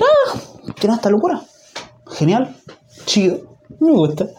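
high-pitched mock delighted reaction; pleasantly surprised. Reacting to someone's crazy quirk, pleasantly surprised. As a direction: act out discovering you like it, pitch up.

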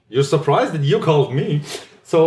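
usual speaking voice

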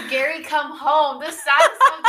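loud, high, breaking into laughter; laughing disbelief. Real, not acted. Telling which song came on in shower, laughing.

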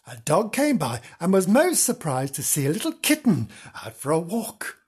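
usual speaking voice

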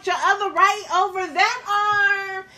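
exasperated dance teacher over Zoom; dance teacher instructing. Shown as a demonstration. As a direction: coach the class through the move over Zoom. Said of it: "So me going up here like".

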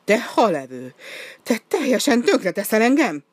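ice-cream lady yells at the penguin, furious; furious scolding. A narrator's reading. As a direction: shout him down, furious. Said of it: "ráripakodott".

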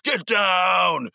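high, loud game-character yell; full-throated, high-pitched yelled command; screamed shout. Acted. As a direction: scream it out like a video game battle cry. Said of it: "scream my head off".

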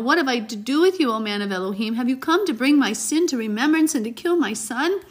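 high, loud accusing cry; anguished outcry. Acted. Grieving widow cries out at the prophet. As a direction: cry out in grief.

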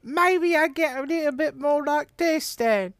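put-on [name]. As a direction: squeak it out like a teen pop idol. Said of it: "it's [name] again".

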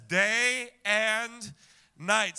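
"Day and night" drawn out loud, both words weighted; stressed day and night. Shown as a demonstration. Pastor quoting scripture to the congregation, stressing "Day and night". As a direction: lean hard on "Day and night". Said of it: "the idea here is repetition".

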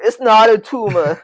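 hammed-up Austrian muscleman quote; Arnold impression. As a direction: drag it out in a heavy Austrian Arnold accent. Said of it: "the Arnold thing".

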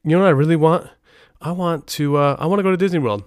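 lightly voiced pleading wife; imitating his wife. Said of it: "wife at the time thought was like".

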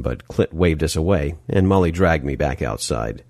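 usual speaking voice

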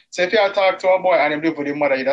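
quick-fire question in Jamaican accent. Shown as a demonstration. Asking the co-host about a boy living with his mother, Jamaican accent. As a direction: put on your best Jamaican accent. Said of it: "in my best Jamaican accent ever".

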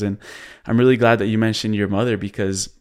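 ordinary delivery

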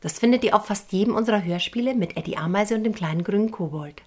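usual speaking voice

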